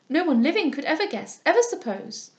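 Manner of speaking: high, loud, impetuous denial; eagerly. A narrator's reading. Ward insisting nobody could guess her secret, eagerly. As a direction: burst out eagerly, pitch leaping up. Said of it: "cried I eagerly".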